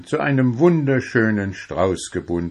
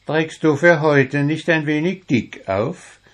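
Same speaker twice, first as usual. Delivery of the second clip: higher, cheerful friend voice; Wuschel, cheerful; 7 semitones higher than usual. A narrator's reading. Wuschel teases Puh about roses, higher and cheerful. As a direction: tease him brightly as Wuschel. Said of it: "fragte Wuschel fröhlich".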